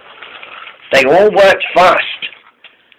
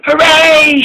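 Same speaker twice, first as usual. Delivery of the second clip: high cheer; joyful hooray much higher; 13 semitones higher than usual. A narrator's reading. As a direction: shout hooray in glee, pitched up. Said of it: "shouted King Arthur".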